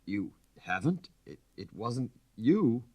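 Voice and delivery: slow, halting stammer of disbelief. A narrator's reading. Count stammers to his wife, slow and halting. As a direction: stumble over the words, barely getting them out. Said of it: "stammered in a scarcely intelligible voice".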